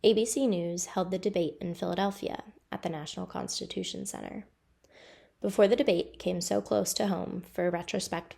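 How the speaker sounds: measured news read for a local report; steady reporter narration over footage; news voiceover. Acted.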